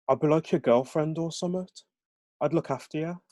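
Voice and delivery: high, lilting Birmingham offer; Brummie accent. Acted. Jesse offering himself to Fraser, in high Brummie accent. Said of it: "aren't familiar with the Brahmi accent".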